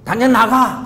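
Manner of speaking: loud, high furious yell to get out; angry shout. Retold or imitated. 화난 엄마가 자녀에게 집에서 나가라고 소리치는 장면, 고함. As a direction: throw the child out of the house. Angry mother throws child out.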